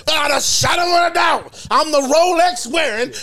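mock wrestling-promo boast; Ric Flair. Arguing Flair was a full-fledged character, hammed-up promo boast. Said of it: "Ric Flair was a full-fledged character".

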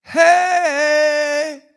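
long, high, loud holler; big drawn-out call from the front door; hollered greeting. Retold or imitated. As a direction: holler it through the house, stretching the vowel. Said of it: "opened up the door and hollered".

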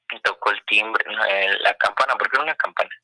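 ordinary talk

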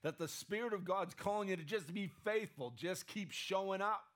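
ordinary delivery

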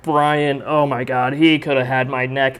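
usual speaking voice

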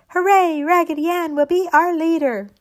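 high loud cheering shout; shouting hooray. Acted. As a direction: cheer it out joyfully.

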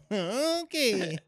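high, put-on goofy "okay"; funny character voice. Comic reacting to all jokes, goofy character voice. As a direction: squeeze it up into a silly high voice. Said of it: "That voice you just did".

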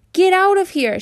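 high, loud yell at an intruder; girl yelling. Acted. Girl yells at a boy in her bathroom, loud high yell. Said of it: "she yelled".

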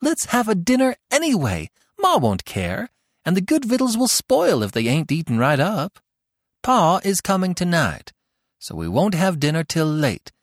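put-on high, eager girl's voice full of importance; bold young housekeeper. A narrator's reading. Tilly planning dinner for her siblings, high eager voice. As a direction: relish being in charge.

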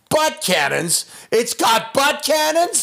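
high, slow cartoon turtle shout; turtle character voice. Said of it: "if I hear Rob as Raphael say".

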